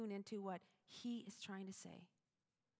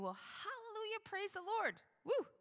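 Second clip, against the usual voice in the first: much higher, bright hallelujah; mock celebration; 12 semitones higher, 6 dB louder than usual. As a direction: cheer it with playful irony. Ironic cheer for calm listeners.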